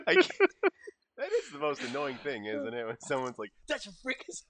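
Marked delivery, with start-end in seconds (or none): 3.7-4.4 high, soft squeal imitating a know-it-all; mock reference-spotter. Player mimics someone calling out a reference, in a high mock squeal. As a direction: imitate an annoying fan, pitched way up and quiet.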